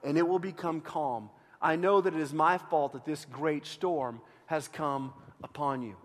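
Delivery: low, soft, solemn reading aloud; scripture reading. A narrator's reading. Preacher reading Jonah's reply to the congregation, low solemn reading. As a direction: read it quietly, letting the voice sink.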